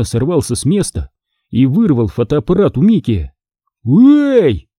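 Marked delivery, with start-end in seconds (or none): 3.8-4.7 high, loud startled "hey"; a bully robbed of the camera, startled and loud; surprised shout. A narrator's reading. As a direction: yelp it as the camera is grabbed. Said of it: "удивлённо воскликнул Микки".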